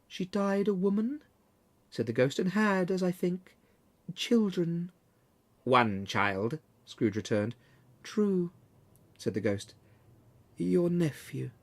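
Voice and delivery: a quiet, tender spirit remembering; soft ghost. Acted. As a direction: ghost speaking gently of Scrooge's sister. Ghost speaks.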